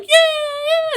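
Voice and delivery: very high, loud whooping cheer; excited crowd cheer. Acted. Crowd cheers at firework burst.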